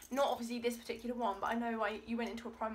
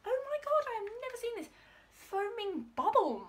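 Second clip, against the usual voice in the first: excited squeal; 11 semitones higher, 6 dB louder than usual. Real, not acted. Thrilled at unexpected gift.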